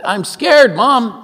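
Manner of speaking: put-on frightened kid calling from bed; scared child. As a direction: plead with mom in a mock child voice. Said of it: "She says".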